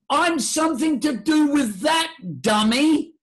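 loud, high, mock scolding shout; exasperated voice of nature. Nature scolds the dummy who ignores breath, high exasperated shout. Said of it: "It says, nature says".

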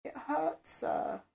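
high whine, close to sobbing; whining near sobs. Acted. Sub tells her Sir the plug hurts, whining near sobs. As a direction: whimper it out, on the edge of tears. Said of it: "I whined, almost sobbed".

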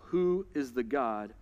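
low, soft menacing taunt; a lightly voiced tyrant's dare; menacing king. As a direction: taunt them low and cold. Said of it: "he goes next level".

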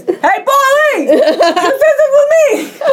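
calling down the stairs, high and playful; yelling across the room. Retold or imitated. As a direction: shout it down the stairwell, half-laughing. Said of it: "I yelled down the stairs".

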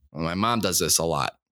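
ordinary talk

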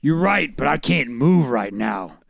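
lightly voiced Batman; a hint of a superhero put-on. Reader voices Bruce refusing to budge, light Batman voice. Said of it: "is that your Batman voice?".

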